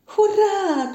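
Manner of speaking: high, loud cheer; joyful shout. A narrator's reading. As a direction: cheer out at full pitch. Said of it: "krzyknęła żabka". (radosny, głośny okrzyk)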